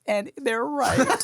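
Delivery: high, slow mock parent voice; mock knowing parent. Parent telling a young man he'll understand, high and slow. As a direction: drag it out like a smug parent.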